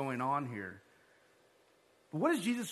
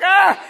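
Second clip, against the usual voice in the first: much higher, much louder dismayed cry; startled yelp; 13 semitones higher, 18 dB louder than usual. Retold or imitated. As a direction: yelp at spilled belongings on the floor. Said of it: "Matt stops, like".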